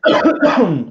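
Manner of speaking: rough throat-clearing cough; smoker's cough. Real, not acted. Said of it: "Smoker's cough".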